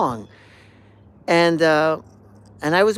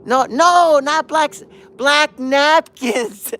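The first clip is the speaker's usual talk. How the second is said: much higher, louder, slow correction; correcting shout; 11 semitones higher, 10 dB louder, slower than usual. Retold or imitated. The neighbor correcting his mistake, shouting down. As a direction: stretch the right title out. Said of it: "neighbor correcting him".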